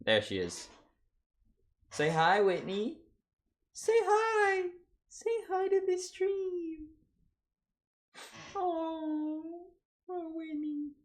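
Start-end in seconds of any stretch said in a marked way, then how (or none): 1.9-10.9 cooing pet voice. Real, not acted. Streamer asking the cat to greet the stream, high cooing. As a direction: coo sweetly in a tiny high voice.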